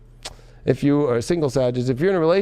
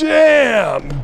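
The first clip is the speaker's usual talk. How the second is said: higher, louder shouted damn; loud exclamation; 7 semitones higher, 6 dB louder than usual. Real, not acted. Reader reacts to the angry card, loud shout. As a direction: burst it out in surprise.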